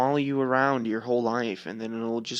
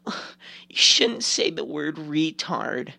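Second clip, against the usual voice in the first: mocking scold; 3 semitones higher, much slower than usual. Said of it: "being like".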